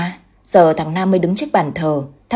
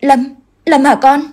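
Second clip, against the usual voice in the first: high, loud, slow, halting disbelief; trembling as she dares to ask; stammering; 7 semitones higher, 5 dB louder, slower than usual. A narrator's reading. Mother asking possessed daughter if it is her son, slow and stammering. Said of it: "Giọng ấp úng".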